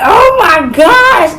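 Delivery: loud, slow wail through tears; a sobbing shout; crying out. Retold or imitated. Hearing the police are coming, a slow crying wail. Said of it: "I'm like".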